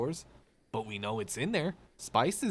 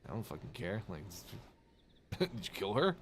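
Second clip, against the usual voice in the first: dismissive mutter; 3 semitones lower, 7 dB quieter, faster than usual. Retold or imitated. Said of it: "they're gonna be like".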